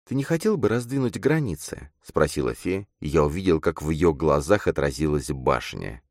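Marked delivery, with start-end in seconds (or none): 0.1-1.9 put-on earnest young woman character. A narrator's reading. Girl urging her friend to want change, earnest. Voices Fe asking.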